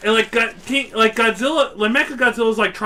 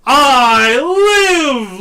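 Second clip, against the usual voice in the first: loud, higher triumphant cry; hammed-up rising monster; mock monster triumph; 6 semitones higher, 9 dB louder than usual. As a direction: announce your return like a cartoon monster. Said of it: "he's like".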